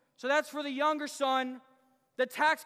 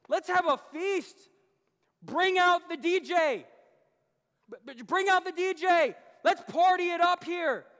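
loud celebratory father ordering a feast; exuberant, loud host's shout; jubilant calling out; 3 semitones higher, 6 dB louder than usual. Retold or imitated. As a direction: call for the party at full voice. Voicing father calling for a party.

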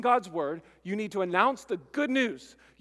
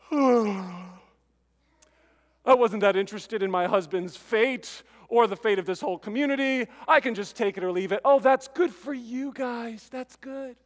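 louder, hammed-up shrug of a voice; mock indifference; 3 semitones higher, 5 dB louder than usual. Mocking a villager who shrugs off the victory news, hammed-up indifference. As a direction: play it bored and uncaring. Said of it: "would any of you hear that and say".

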